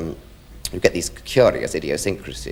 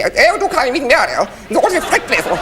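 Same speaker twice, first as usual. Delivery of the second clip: much higher, louder hammed-up snobbish voice; mocking the awful frightful middle-class accent; mock posh snob; 5 dB louder than usual. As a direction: parody the tight, pinched middle-class snob. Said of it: "that awful frightful sort of".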